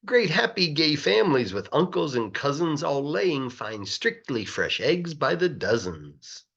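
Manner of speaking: ordinary delivery, nothing marked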